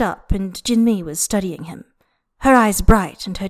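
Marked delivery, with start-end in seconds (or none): none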